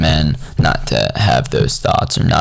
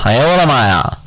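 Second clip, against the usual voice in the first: mock exclamation; 6 semitones higher than usual.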